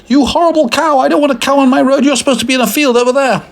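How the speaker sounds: fast, very high-pitched rant; hammed-up irate driver; angry screaming. A narrator's reading. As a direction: scold furiously in a squeaky shriek. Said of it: "screamed the man".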